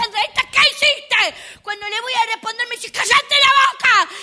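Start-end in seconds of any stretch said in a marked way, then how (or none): 0.0-1.6 high, slow angry mother's voice. Retold or imitated. Angry mother confronts her daughter, high and slow. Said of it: "Mi mamá cuando se enoja te dice". 2.9-4.1 high, loud mock yell of a mother; angry mother yelling. Mother cuts off her daughter, high mock yell. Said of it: "Mi mamá cuando se enoja".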